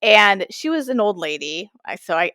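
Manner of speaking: ordinary delivery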